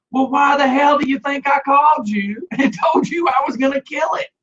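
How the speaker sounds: high-pitched, hollered comeback; exasperated retort. Retold or imitated. Caller telling the dog catcher off, exasperated retort. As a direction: holler it back high, retelling an argument.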